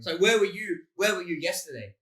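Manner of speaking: high, loud mock interrogation; hammed-up soap opera confrontation; soap opera accuser. Jealous soap character asking about yesterday, mock dramatic.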